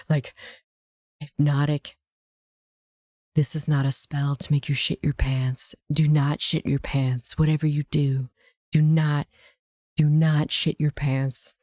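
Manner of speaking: low, hypnotic mock spell; too-evil attempt at calming ASMR; malevolent ASMR voice. Shown as a demonstration. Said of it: "if I try to do A S M R voice".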